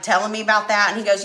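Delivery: normal talking voice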